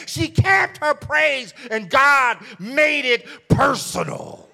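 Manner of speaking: loud chanted sermon climax, slowing at the end; full-force preaching. Acted. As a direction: preach it out, rising to a sung shout.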